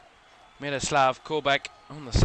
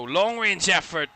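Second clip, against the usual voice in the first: sudden rise, called out loud; excited call; 5 semitones higher, 6 dB louder than usual. Real, not acted. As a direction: lift the voice for the shot. Commentator calls a long shot.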